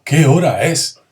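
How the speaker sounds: loud amazed exclamation; astonished. Shown as a demonstration. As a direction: act surprised at the hour. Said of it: "una frase de asombro".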